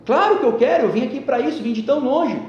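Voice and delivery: high, loud, indignant; loud insistence. Retold or imitated. Boy shouts at the sage that he came so far, loud and indignant. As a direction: shout that of course you want it.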